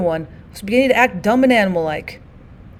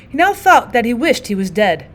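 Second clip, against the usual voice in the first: plain reading voice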